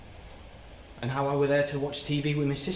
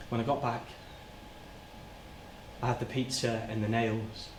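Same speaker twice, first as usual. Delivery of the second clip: low, soft, halting memory; reliving it in small fragments, with long pauses; quietly dreading each detail; hushed, haunted recollection; 5 semitones lower, 11 dB quieter than usual. Acted. Haltingly recalls finding the victim.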